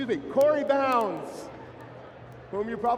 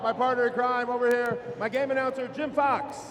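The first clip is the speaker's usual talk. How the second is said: usual speaking voice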